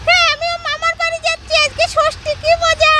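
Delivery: put-on high, gleeful little kid; excited child voice. Child thrilled to visit uncle's house, excited high child voice. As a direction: cheer in a very high child's pitch.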